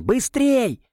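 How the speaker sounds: high urgent shout. A narrator's reading. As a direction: hurry them with a yell. Герой торопит Марьяну. (срочный высокий крик)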